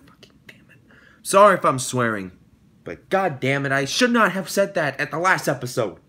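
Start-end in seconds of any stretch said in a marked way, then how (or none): 0.1-0.8 under-the-breath curse at himself; muttered curse. Real, not acted. Whispered curse as he regrets a promise to viewers. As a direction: whisper it, annoyed with yourself.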